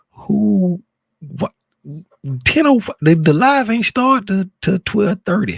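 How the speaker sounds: high, loud disbelief; shocked the chat started early; surprised. Real, not acted. Streamer startled by early comments, loud disbelief.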